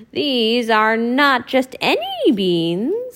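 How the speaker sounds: put-on old woman tempting Mickey. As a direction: croon the offer like an old crone. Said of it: "said the old woman".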